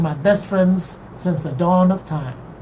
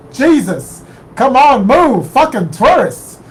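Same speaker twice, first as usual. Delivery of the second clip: loud, slow, exasperated New York shout; angry yell; 5 semitones higher, 6 dB louder, slower than usual. Retold or imitated. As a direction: bark it at the clueless tourist. Angry New Yorker yelling at narrator.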